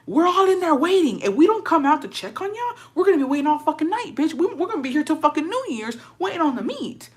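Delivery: exasperated scolding. Retold or imitated. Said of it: "I was like".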